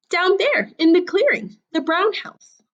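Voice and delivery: put-on high, light girlish voice; little girl voice. A narrator's reading. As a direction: point and chirp like a little girl. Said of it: "Little Red pointed".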